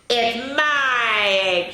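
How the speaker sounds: slow, drawn-out indignant claim; slow mock-sputtering bird. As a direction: sputter it out slowly. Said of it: "she sputtered".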